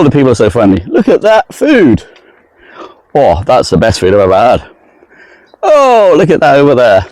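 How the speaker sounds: high, gushing mock amazement, dropping between exclamations; mock travel vlogger. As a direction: parody an over-enthusiastic vlogger wowed by everything. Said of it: "it is still the same old".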